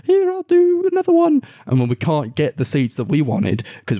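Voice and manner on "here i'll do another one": very high, falsetto apology; squeaky apologetic cashier. Retold or imitated. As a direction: mimic a flustered cashier squeaking sorry.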